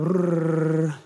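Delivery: long rolled "brrr" for piano; imitated piano roll. Preacher imitating the church piano, rolled instrument noise. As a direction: trill a piano flourish with the mouth. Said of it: "and the piano".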